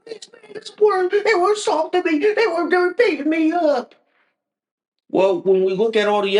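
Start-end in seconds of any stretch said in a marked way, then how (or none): 0.0-3.9 high whiny victim voice; mocking high whimper; mock crybaby. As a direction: whine it like a crybaby playing hurt. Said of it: "And then they go crying like".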